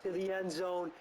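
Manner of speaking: announcer call building toward the throw; rising broadcast build-up; building play-by-play. Acted. Calling the last play of the game, building tension.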